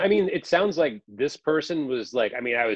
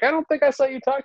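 quick, high, half-laughing comeback; fast amused jab, voice pitched up; laughing retort; 13 semitones higher, 3 dB louder, faster than usual. Retold or imitated. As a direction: laugh through the sarcastic reply. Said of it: "And I was like".

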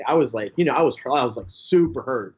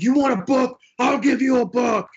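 higher, louder mock defiance, tossing it back; defiant comeback; 10 semitones higher, 3 dB louder than usual. Daring his publisher, a higher, louder mock-defiant retort. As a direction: throw down the challenge. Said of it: "I was like".

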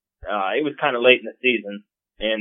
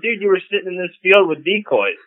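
incredulous retort, pitched up; talking down to the guy who cut him off; mock exasperated comeback; retorting to the hunter; 8 semitones higher than usual. Retold argument with rival hunter.

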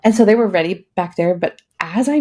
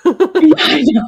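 louder and higher through real laughter; laughing; 8 semitones higher, 6 dB louder than usual. Real, not acted. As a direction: laugh along with the host. Laughing with host.

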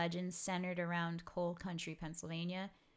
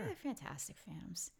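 unvoiced, playful aside; playful whisper; 6 dB quieter than usual. Real, not acted. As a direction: whisper it like a secret joke. Mock secretive whisper about team.

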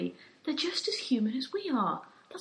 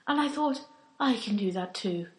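ordinary talk, nothing marked

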